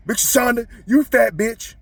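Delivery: high-pitched taunting yell; retold loud taunt. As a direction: fling the insult out high and loud.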